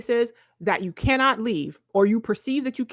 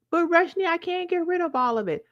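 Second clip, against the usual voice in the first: whiny objector; 8 semitones higher than usual. Pretend. Voicing a viewer's objection.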